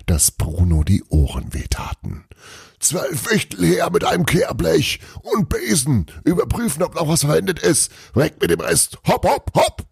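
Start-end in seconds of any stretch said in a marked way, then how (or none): 2.8-9.9 much higher barked commands; bellowing orders. A narrator's reading. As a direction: shout orders across the hall. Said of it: "brüllte plötzlich".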